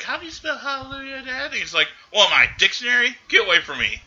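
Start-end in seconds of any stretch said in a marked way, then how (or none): 0.0-1.5 put-on high, small child asking innocently; kid's voice. Podcaster voicing the daughter asking her dad, high childlike. Father voices his kid in retelling. 2.1-4.0 higher, louder irritated brush-off; mock angry dad. Retelling George snapping at his daughter, mock angry.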